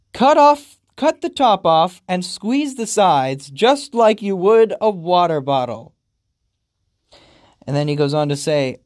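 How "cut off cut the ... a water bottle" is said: loud, high mocking repeat; mocking reread. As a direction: ridicule the absurd line. Rereading absurd line.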